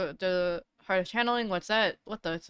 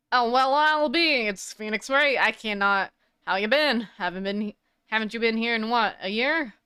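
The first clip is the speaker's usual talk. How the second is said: put-on Southern accent; 3 dB louder than usual. Southern photographer greets old acquaintance.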